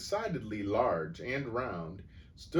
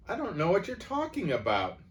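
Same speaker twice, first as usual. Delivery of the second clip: much higher bewildered protest; puzzled; 11 semitones higher, 4 dB louder than usual. A narrator's reading. Boy denying the theft, more puzzled. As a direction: plead baffled innocence. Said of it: "more puzzled than ever".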